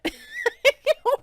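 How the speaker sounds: sudden high giggle, loud; high burst of laughter. Real, not acted. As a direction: break into a squealing laugh.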